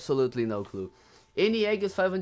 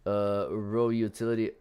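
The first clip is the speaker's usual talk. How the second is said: ordinary delivery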